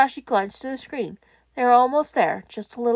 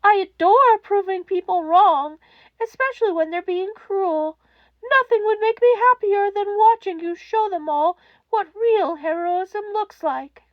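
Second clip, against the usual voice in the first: higher, eager, slowing at end; principal's voice; 9 semitones higher, 5 dB louder than usual. A narrator's reading. Principal vows to back the quirkless child, eager. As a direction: relish proving them wrong.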